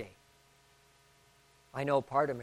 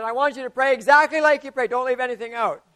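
higher, louder insistent order; bossy patient; 8 semitones higher, 13 dB louder than usual. Retold or imitated. Patient ordering the pastor to pray it all, loud and high. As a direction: insist on the full prayer.

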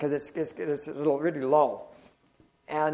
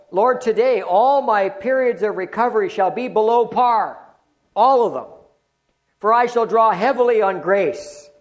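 louder, proclaiming prayer; bold declaration; 4 semitones higher, 7 dB louder than usual. Acted. Pastor proclaiming a faith confession to the congregation, loud declaration. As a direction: declare it over the room with force.

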